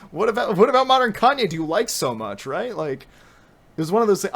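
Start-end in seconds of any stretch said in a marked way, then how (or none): 0.0-2.8 high, loud sarcastic questioning; mock interest, laughing. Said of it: "I was like".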